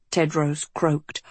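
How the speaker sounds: ordinary delivery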